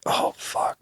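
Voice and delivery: breathy whispered curse; hushed oh no under the breath; whispered dread. Retold or imitated. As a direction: whisper it, caught red-handed. Caught with the weed cartridge.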